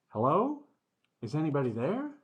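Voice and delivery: high questioning call, rising at the end. Acted. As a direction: call out to see if anyone answers. Calling out to the hidden thing.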